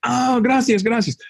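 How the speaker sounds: very high-pitched mock-delighted thanks; imitated grateful woman. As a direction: squeal your thanks as if you finally understood. Imitating lady finally understanding him.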